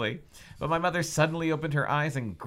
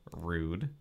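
ordinary talk

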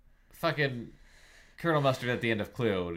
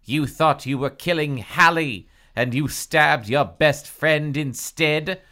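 louder, higher incredulous rebuke; furious accusation; 5 semitones higher, 6 dB louder than usual. Acted. Dina berates Tracy over wrong victim, furious accusation. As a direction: drive the blame home angrily. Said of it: "Dina shrieked in fury".

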